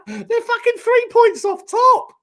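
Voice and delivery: loud, high, laughing squeal; cracking up, words stretched high; real incredulous laughter. Real, not acted. Cracking up at Villa's rise.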